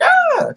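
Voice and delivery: sudden high mock yelp; startled yelp. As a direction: yelp as if yanked out. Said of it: "Madara's like".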